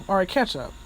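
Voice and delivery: ordinary talk, nothing marked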